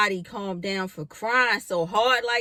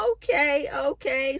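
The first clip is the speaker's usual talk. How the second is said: raised, breathless acceptance; high-pitched okay; 7 semitones higher than usual. Retold or imitated. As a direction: squeak it out, dazed. Stunned follow-up.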